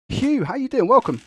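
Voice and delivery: high, bright hello to a viewer; excited greeting. Real, not acted. Streamer welcomes Hugh from chat, excited and high.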